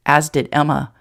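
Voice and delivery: ordinary delivery, nothing marked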